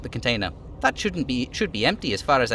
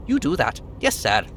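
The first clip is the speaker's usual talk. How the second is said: usual speaking voice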